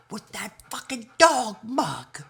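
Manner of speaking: high, loud pet voice; high dog voice. Pretend. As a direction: squeal it as the dog. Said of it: "voicing the dog".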